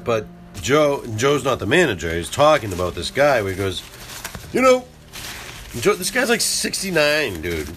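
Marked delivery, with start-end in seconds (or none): 4.5-7.7 Joe voice. Retold or imitated. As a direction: pitch way up as Joe. Joe voiced in retold story.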